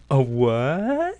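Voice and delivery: puzzled rising a what, imitating the actor; flat deadpan question, rising at the end; mock-innocent local asking a what. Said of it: "delivered in the most perfect cadence and tone".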